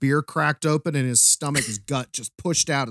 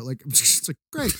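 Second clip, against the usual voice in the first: ordinary talk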